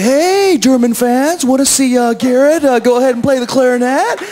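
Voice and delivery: high, loud hype-man call; imitated colleague shouting to an audience; rallying the crowd. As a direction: whip up the fans like an MC. Voicing colleague rousing the crowd.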